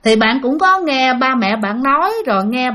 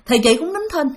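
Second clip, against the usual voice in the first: plain reading voice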